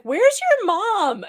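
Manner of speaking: loud high slow where's your mom; exasperated where's your mom. Retold or imitated. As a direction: stretch it out in disbelief. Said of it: "And I was like".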